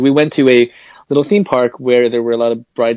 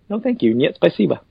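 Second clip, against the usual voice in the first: polite refusal; 5 semitones higher than usual. Shown as a demonstration. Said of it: "politely nod and say".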